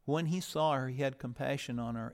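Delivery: usual speaking voice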